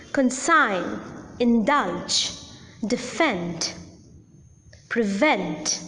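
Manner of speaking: conSIGN, inDULGE, deFEND, preVENT; second-syllable stress. Shown as a demonstration. Teacher gives verb examples consign, indulge, defend, prevent, stressed on the second syllable. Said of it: "the accent will fall on the second syllable".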